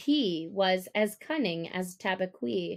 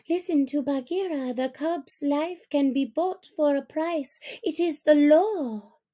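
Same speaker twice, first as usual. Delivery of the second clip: higher, quick excited voices; eager wolves; 5 semitones higher than usual. Acted. Young wolves urging the council, high and eager. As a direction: hurry the approval, pitched up.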